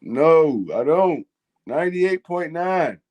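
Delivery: ordinary talk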